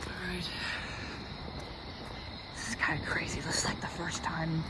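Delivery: soft unvoiced whisper. Real, not acted. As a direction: whisper so nobody hears. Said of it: "I'm being quiet because there are houses nearby".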